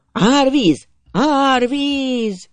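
high, drawn-out warning cry; howled alarm. A narrator's reading. Galloping lad warning of the flood, high howling shout. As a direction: howl the warning across the fields. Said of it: "üvöltötte".

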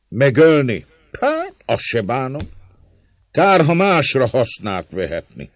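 hangos, magasabb szónoki hang; harsányan szólj a bosszúra éhes tömeghez; népszónok. A narrator's reading. (szónokolva, emelt hangon)

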